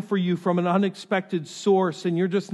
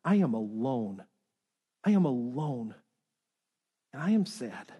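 honest admission; 5 semitones lower than usual. Acted. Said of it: "He's just honest".

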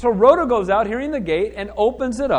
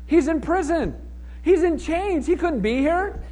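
high-pitched, insistent dismissal; scoffing disbelief; 7 semitones higher, slower than usual. Acted. Praying believers rejecting Rhoda's news, in high-pitched disbelief. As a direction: argue it's impossible, pitched up.

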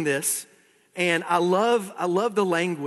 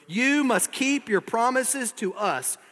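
high, slow, insistent demand; exclaiming; 7 semitones higher, slower than usual. Acted. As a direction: exclaim it, stretching each word insistently. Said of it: "exclaimed Dorothy".

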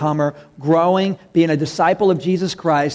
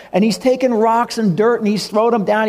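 ordinary delivery

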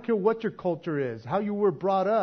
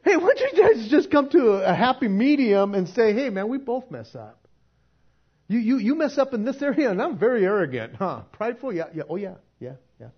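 mediating dad voice; 5 semitones higher, 7 dB louder than usual. Retold or imitated. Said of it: "It's like".

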